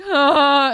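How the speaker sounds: loud, high drawn-out moan; imitated wailing moan; mock moan. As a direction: moan it out loudly, hammed up. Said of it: "while you're moaning".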